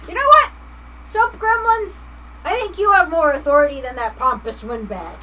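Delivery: high, loud declaration; loud exclamation. Real, not acted. Streamer hailing the soap gremlins, loud and high. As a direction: proclaim it with gusto.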